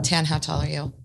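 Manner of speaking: ordinary talk, nothing marked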